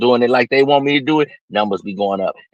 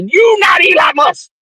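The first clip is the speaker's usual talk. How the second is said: higher, louder, slow yell; shouting rebuke; 4 dB louder, slower than usual. Acted. Host yelling back at the whining young man. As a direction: shout him down, word by word.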